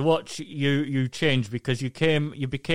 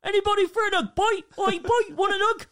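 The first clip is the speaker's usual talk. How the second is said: high, hammed-up lad offering hugs; mock rowdy lad. Imitating friend offering hugs.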